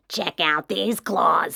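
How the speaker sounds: slow rasping monster voice. A narrator's reading. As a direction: rasp it out from under the bed. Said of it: "a third voice from under the bed rasped".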